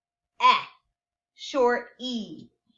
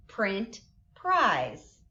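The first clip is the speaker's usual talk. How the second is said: usual speaking voice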